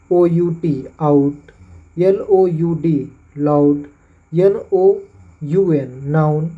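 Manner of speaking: slow letter-by-letter spelling then the word; slow spelling demo. Shown as a demonstration. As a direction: spell it out slowly, then say आउट, loud, noun. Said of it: "उसके बाद है". (धीरे धीरे spelling और उच्चारण)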